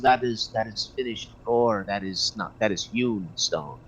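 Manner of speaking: low, soft musing to oneself; lightly voiced dwarf. Game master voices the dwarf identifying stone, low and quiet. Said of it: "the dwarf goes".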